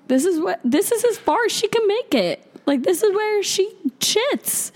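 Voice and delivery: exasperated retort. Retold or imitated. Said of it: "And I was like".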